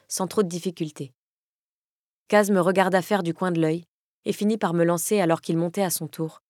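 ordinary delivery, nothing marked

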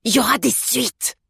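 furious. A narrator's reading. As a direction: repeat the threat, incensed. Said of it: "répéta le facteur furieux".

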